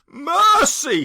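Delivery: high, loud cry for mercy; pleading cry. Acted. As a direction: beg the phantom in fright. Scrooge begging the ghost.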